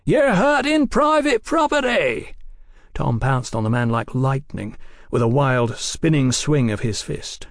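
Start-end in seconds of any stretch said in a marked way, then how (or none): 0.0-2.3 high-pitched echoing howl. A narrator's reading. Prisoner hailing the wreckers down the alley, howling. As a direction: howl it out to carry. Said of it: "His howl echoed down".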